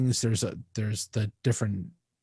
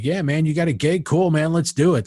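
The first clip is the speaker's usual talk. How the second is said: higher, louder, keen; eager yes; 5 semitones higher, 4 dB louder than usual. Retold or imitated. Youngstown friends accepting a gig call, eager. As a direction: jump right at the offer. Eager musicians' reply quoted.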